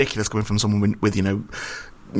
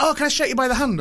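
eager fan voice; 11 semitones higher than usual. Retold or imitated. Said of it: "And he went".

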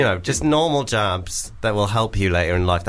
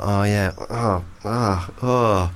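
slow, groggy fake stirring; pretend waking up; 4 semitones lower, much slower than usual. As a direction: stir slowly as if just woken. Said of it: "pretend to wake up".

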